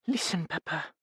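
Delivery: soft, high and tender; tender quiet plea; murmured. Acted. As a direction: murmur it gently to papa. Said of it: "she murmured".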